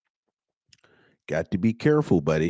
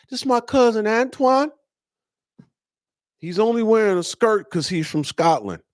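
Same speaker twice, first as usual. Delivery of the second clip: mock cousin intro; 10 semitones higher than usual. Mock-voicing a man introducing his "cousin.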